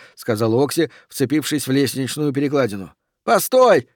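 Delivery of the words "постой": high, loud urgent call; shouting. Acted. Daffy stops his companion on the ladder, shouting. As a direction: call out sharply to halt someone. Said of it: "закричал Даффи".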